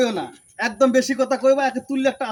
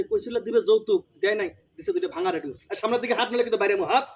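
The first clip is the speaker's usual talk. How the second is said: normal talking voice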